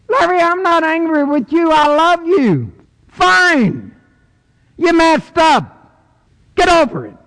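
high, loud, ringing preacher voice; full-force preaching. Acted. Preacher proclaims God's love to the congregation, at full force. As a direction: preach it out to the back pew.

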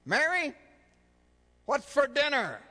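the husband raises his voice right beside her; last, loudest and highest call for dinner. Acted. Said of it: "for the fourth time".

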